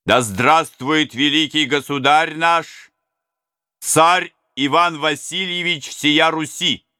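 high, slow, loud ceremonial toast; solemn toast. Acted. Serebryany to the robbers, slow solemn toast. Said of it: "сказал Серебряный".

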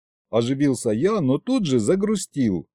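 ordinary delivery, nothing marked

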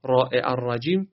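الرجیم with a heavy, thick ر; thick ra demo. Shown as a demonstration. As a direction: stress راء and read it thick.

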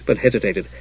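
usual speaking voice

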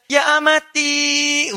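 very slow, drawn-out, high Arabic call; mock call to mother. Child returning from class calls his mother, slow and high. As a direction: stretch it out sweetly like a pious child. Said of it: "Masya Allah".

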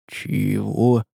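hushed, stunned question; taken aback. A narrator's reading. Man hearing the revelation, taken aback. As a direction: ask it in muted bewilderment. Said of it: "опешил Тихомир".

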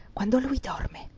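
hushed, cold conspirator's reply; icy whisper. A narrator's reading. Maid secretly answers her mistress about the man, icy whisper. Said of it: "V'era nella voce di Elisa un gelo".

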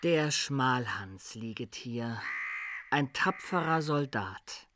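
slow and quiet, a grave inscription read aloud; solemn epitaph recital. Acted. Simplicius reciting his dead master's epitaph, slow and solemn. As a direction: intone it slowly like words carved on a tomb. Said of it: "Grabschrift machen".

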